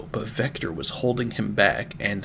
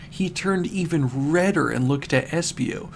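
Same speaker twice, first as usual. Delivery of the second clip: plain reading voice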